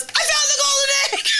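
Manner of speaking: very high, gleeful squeal; mock triumphant shout. Mom imitates son grabbing the egg, squealing triumphantly. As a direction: crow it at the top of the voice, laughing. Retold boy's triumph, then runs.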